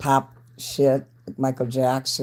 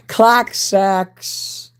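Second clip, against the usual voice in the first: shouted out high and loud; loud catchphrase; 7 semitones higher, 6 dB louder than usual. Shown as a demonstration. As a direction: belt it like a catchphrase.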